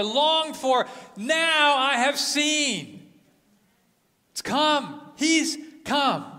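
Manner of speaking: put-on high, loud, overjoyed cry of an old man whose wait is over; Simeon's joy. Old man crying out to the Lord, high and loud with joy. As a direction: voice lifted high and loud, pouring out relief and joy. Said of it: "Can you imagine this man's joy?".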